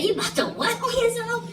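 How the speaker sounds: high, loud, fast incredulous question; shocked disbelief. Retold or imitated. Asking his friend in high shocked disbelief. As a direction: squeak it in total disbelief.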